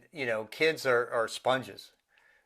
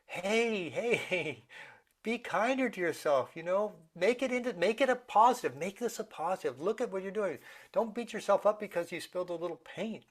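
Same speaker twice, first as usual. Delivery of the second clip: higher, softened coaxing voice; gentle parental comfort; 4 semitones higher, 4 dB quieter than usual. Retold or imitated. Parent to son upset over spilled paint, gently coaxing. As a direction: talk an upset child down gently.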